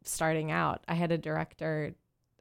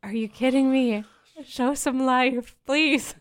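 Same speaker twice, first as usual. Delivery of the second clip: loud, high, slow plea; mock pleading, high and loud; exasperated outburst; 7 semitones higher, 8 dB louder, slower than usual. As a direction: beg the speaker to show some life. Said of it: "I was like".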